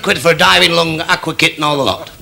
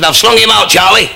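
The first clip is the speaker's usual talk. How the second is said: put-on higher, louder drunken shout to a mate; calling out; 6 semitones higher, 5 dB louder than usual. Drunk tells Charlie across the room, calling out. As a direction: call it across the room, pitched up.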